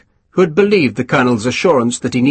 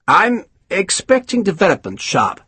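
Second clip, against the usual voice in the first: lightly voiced self-satisfied officer; a hint of a smug English colonel; pompous colonel; 8 semitones higher than usual. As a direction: savour each word, pleased with yourself. Colonel briefing Sharp.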